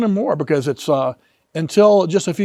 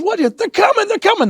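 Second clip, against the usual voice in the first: high frightened cry that they're coming; panicked child. Retold or imitated. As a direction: sound like a terrified little boy.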